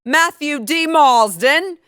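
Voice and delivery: hammed-up British name; British accent. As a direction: announce him like a posh Brit. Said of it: "I'm gonna do that from now on".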